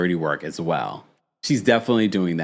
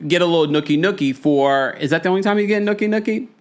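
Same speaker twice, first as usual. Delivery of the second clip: teasing; 8 semitones higher than usual. Real, not acted. Teasing disbelief at nookie comment.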